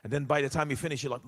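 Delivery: usual speaking voice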